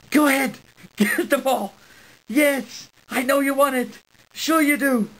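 coaxing dog voice. Real, not acted. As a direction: cheer the dog on like a coach. Coaxing dog to fetch ball.

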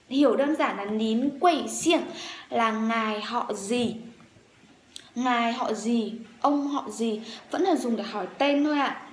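ordinary talk, nothing marked

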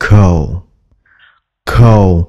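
coal said with a slightly affected rounded o; a touch of flourish on coal; hơi điệu coal. Shown as a demonstration. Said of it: "Hơi điệu điệu một chút nha".

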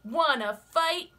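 high, loud, grumpy bug; grouchy ladybug voice. Acted. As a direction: bark the challenge up high. Ladybug challenges sparrow.

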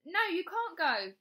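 high, loud, drawn-out protest; shouting. A narrator's reading. Bradley stopping mother seeing teacher, shouting. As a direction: shout a stamping-foot refusal. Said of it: "he shouted".

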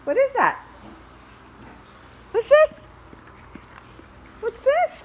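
squeaky high voice for the puppy. Real, not acted. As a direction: coo at the dog in a high sing-song. Cooing at dog over toy.

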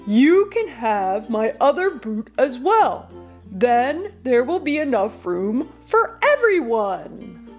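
eager storybook giant's offer; excited giant. A narrator's reading. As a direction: announce the bright idea as a giant. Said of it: "he shouted".

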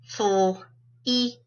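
fl, then "eat" in separate sounds; sounded out. Shown as a demonstration. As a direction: break fl, eat apart with pauses. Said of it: "We're gonna sound them out".

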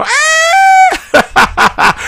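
grito agudo de susto, entre risas; grito imitado. Retold or imitated. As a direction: imita el chillido de la muchacha. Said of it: "gritó". (chillido burlón de espanto)

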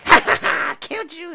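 high, loud cackling gloat; villain gloating. Retold or imitated. Host quoting the villain's gloat to the players, high mocking cackle. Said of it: "that bit where she's like".

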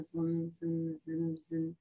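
high, soft pulsed "zzz"; buzzing sound effect. Acted. Storyteller voices the foam robot appearing, soft buzzing. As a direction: buzz lightly in even high pulses.

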